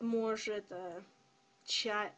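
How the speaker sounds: usual speaking voice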